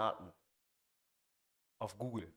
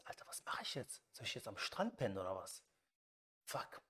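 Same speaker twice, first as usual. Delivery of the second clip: desperate whisper; 5 semitones lower, 9 dB quieter than usual. Retold or imitated. Despair, no hotel free.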